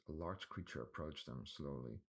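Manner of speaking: plain reading voice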